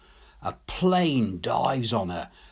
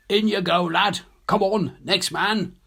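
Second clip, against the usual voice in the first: brisk call; 8 semitones higher, 4 dB louder than usual. Acted. Rescuer hauls men aboard.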